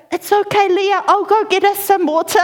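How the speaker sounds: put-on high child voice; high-pitched, earnest little girl; helpful big sister. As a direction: imitate a child comforting her sibling. Six-year-old reassuring younger sister.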